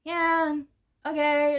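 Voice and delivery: softened, lukewarm mock response; half-hearted, underwhelmed murmur; unenthused crowd. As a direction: imitate a crowd that cannot be bothered. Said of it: "everybody was just kinda like".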